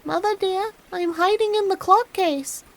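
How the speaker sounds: put-on high, small kid's voice; hiding little kid calling to mother; youngest kid's soft voice. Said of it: "a soft voice cried out".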